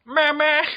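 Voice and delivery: high loud sung dun-dun sting; a cappella Law and Order beat. Acted. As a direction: belt the show's musical sting. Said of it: "do all the music beats, a cappella".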